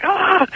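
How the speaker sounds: high yelp of pain from a beaten driver; a sudden high cry, at normal volume; mock scream of the guy being clubbed; retold scream. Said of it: "And he's like".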